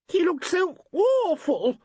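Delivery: very high, slow, hysterical wail; a horrified woman losing control; high, shaking hysterical cry. A narrator's reading. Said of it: "voice rose hysterically".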